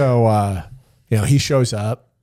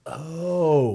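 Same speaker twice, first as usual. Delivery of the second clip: surprised oh; 8 semitones higher than usual. Retold or imitated. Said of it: "And I'm like".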